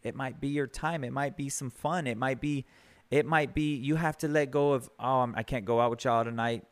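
ordinary delivery

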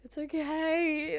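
high, slow soothing it's okay; family mock-soothing a panicking daughter, slow and high; mock reassuring. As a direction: imitate the family calming her down. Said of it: "My whole family's like".

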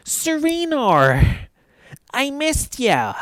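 high, slow, sugary fake friendliness; fake sweet greeting. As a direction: draw out the name with a fake smile. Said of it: "puts the fake B F F smile on".

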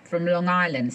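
ordinary talk

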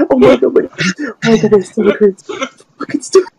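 laughing hard. Real, not acted.

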